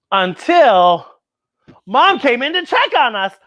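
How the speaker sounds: plain reading voice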